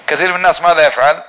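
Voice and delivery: normal talking voice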